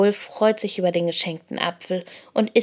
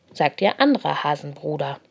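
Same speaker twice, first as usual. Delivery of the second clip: plain reading voice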